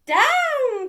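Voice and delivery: high, loud cry of "Down!"; cried out. A narrator's reading. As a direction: shout the command to land. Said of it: "cried the witch".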